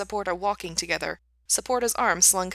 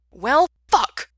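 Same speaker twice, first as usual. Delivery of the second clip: loud, high, angry curse; pissed off; 7 semitones higher, 10 dB louder than usual. A narrator's reading. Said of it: "G sounded pissed".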